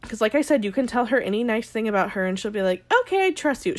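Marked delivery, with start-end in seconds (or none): 3.0-3.8 high-pitched, gullible little agreement; mock trusting. Host mimicking a woman who trusts too easily, mock trusting. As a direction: imitate someone won over far too easily. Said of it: "She just trusts too easily".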